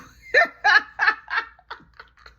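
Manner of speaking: a loud, very high shriek of laughter. Real, not acted. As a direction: scream with laughter. Cracking up at KO.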